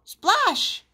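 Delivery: high, exclaimed splash; splash effect. A narrator's reading. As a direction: let it leap up high. Sound effect in story.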